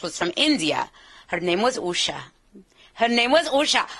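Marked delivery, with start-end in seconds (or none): 2.9-3.9 higher, lilting Indian English; Indian accent. Shown as a demonstration. Imitating her close associate from India, Indian accent. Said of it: "she talked like this".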